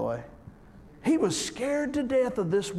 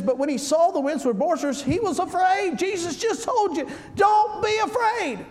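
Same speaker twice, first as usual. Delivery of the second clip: fervent preaching; 11 semitones higher than usual. Real, not acted. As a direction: build the verse to a ringing climax. Preaching peak.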